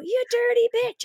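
high, slow jeering taunt; mock crowd taunt. Audience jeering the woman from the toilets, high mocking taunt. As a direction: jeer her like a heckling crowd. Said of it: "And they were".

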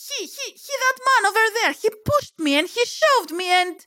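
high-pitched, spluttering indignation; furious rant. Acted. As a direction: protest hotly, voice way up. Heroine rants, quarrel past stopping. (έξαλλο ξέσπασμα με ψηλή φωνή)

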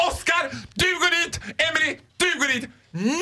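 high, imperious shout; mock screeching orders. Shop queen ordering staff around, mock screeching. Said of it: "Skriker de bara".

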